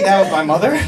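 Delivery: ordinary delivery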